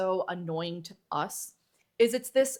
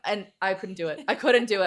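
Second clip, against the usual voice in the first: normal talking voice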